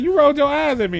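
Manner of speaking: playful imitation of an indignant accuser; mock accusation. Retells woman accusing him.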